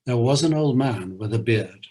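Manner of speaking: lilting poem reading with a bouncy beat; limerick reading. Acted. Host reading a Lear limerick aloud, lilting recitation. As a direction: recite it like a nonsense rhyme for children.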